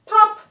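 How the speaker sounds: a sharp, high, loud popping sound; loud pop. A narrator's reading. Narrator voicing the magic pop, sharp and loud.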